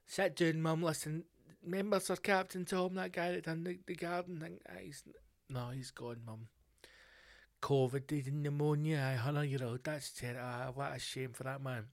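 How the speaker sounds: hushed, gentle breaking of news; soft telling off. Retold or imitated. Son quietly telling his mum that Sir Tom has died.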